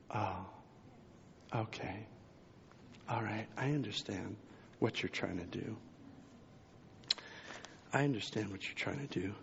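low, quiet, settling understanding; hushed dawning acceptance; calm realization. Retold or imitated. Pastor recalling his talk with God, low and quiet. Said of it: "I began to remember".

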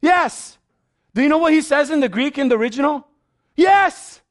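high, loud, exultant preaching; preaching at full force. Acted. Pastor voicing Jesus' delight in the children's praise, exultant. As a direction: shout each line with joyful conviction.

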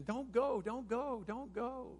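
high repeated plea, softening as it goes; acted-out imploring; begging. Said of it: "They were begging".

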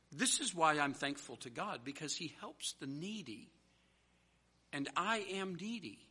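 soft, heartfelt confession from the pulpit; hushed preaching. Real, not acted. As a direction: drop the volume for personal gratitude.

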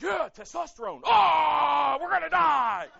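loud, high hyped-up yelling; mock pumped-up shout. Guys at camp getting pumped, shouting high and loud. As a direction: yell it like pumped-up guys ready to fight.

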